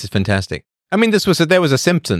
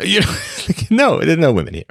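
higher, laughing mock reply; mock answer; 8 semitones higher than usual. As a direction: toss it off with a laugh, mocking the callers. Mocking reply about the party line.

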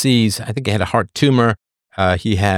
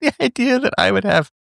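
high, laugh-squeezed words; helpless laughter; 9 semitones higher than usual. Real, not acted. Host mocking the idea of that show, laughing helplessly. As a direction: barely get it out through laughter.

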